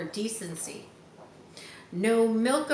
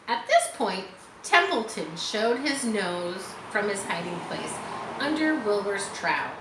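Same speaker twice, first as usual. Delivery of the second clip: plain reading voice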